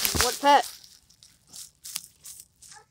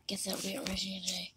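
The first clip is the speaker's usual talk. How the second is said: soft hushed whisper; 9 semitones lower, 16 dB quieter, faster than usual. Real, not acted. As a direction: whisper it to yourself. Whispered aside.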